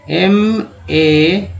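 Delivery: drawn-out letter names M A; slowly sounded out letters. Shown as a demonstration. Teacher spelling the word for students, slow and drawn out. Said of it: "अब देखिए एम ए".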